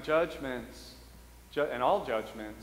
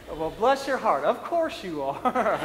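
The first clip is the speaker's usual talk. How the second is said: a sarcastic bless your heart through laughter; fake-sweet, giggling at the end; priest's unspoken snide reply, laughing; laughing mock sympathy; 4 semitones higher, 6 dB louder, slower than usual. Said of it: "I said it in my head".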